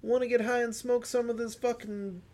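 high, loud laid-back stoner voice; hammed-up dealer offering weed; mock stoner dude. Friend voicing a stoner pitching weed, high and loud.

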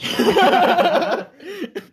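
long, loud, high laughter. Real, not acted. Nobody came out of the store, laughing loudly.

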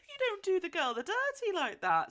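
high, loud playful outrage; mock scolding. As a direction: tell off a villain at full pitch.

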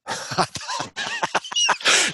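high-pitched burst of laughter; real laugh. Real, not acted. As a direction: laugh long at your own failure. Laughs at his own bombing.

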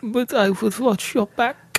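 high, slow, veiled German threat; creepy German voice. Retold or imitated. Creepy German villain bit.

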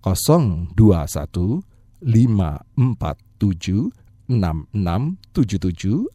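very slow digit by digit; slowly repeated. Shown as a demonstration. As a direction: spell the number out slowly. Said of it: "Saya ulangi pelan-pelan".

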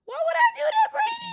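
high-pitched, loud outraged squeal; loud, very high indignant question; incredulous protest. Real, not acted. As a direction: shriek it in disbelief. Incredulous protest at the suggested prank.

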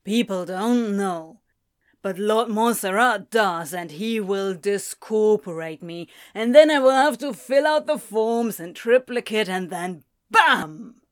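put-on building to a loud drunken outburst; drunken rant building. Drunk demon ranting to the angel about paperwork, rising loud.

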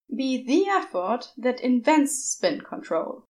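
ordinary delivery, nothing marked

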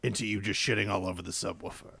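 low, quiet Howard Stern-style command; bossy radio host. Retold or imitated. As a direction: boss Kyle around on air. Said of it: "we just tell Kyle to do things like".

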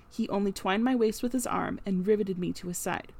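ordinary delivery, nothing marked

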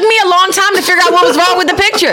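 talking through laughter, fast and high; laughing. Real, not acted. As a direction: laugh while telling it. Laughing while retelling.

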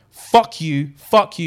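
loud, punched mock outburst; acted angry tirade. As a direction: launch into a hammed-up row. Acted break-up quarrel with John.

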